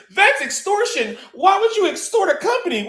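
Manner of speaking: high, whiny mock voice of the corporate chat; mocking corporate goons. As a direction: squeak it out like a prissy corporate drone. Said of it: "here's what the corporate goons ... are gonna say".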